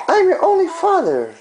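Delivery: laughing high through the words. Real, not acted. Father laughing as baby Grace kicks him. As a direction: chuckle it out, amused.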